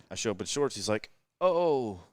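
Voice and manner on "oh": higher, drawn-out "oh" of understanding; mock dawning realization; retold realization. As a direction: imitate someone finally catching on. Retelling friend's sudden realization.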